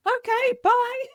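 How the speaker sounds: a high, loud, breezy take on her son; son's voice. Retold or imitated. Mother retelling her son's casual reply, high and loud.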